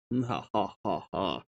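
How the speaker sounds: usual speaking voice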